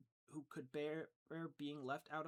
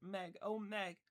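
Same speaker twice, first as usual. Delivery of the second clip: crying out; 5 semitones higher, 5 dB louder, slower than usual. Acted. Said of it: "Charles Wallace was crying out".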